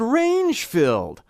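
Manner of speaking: range-filled swung up into head voice; head resonance. Shown as a demonstration. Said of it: "I went up into my head resonance".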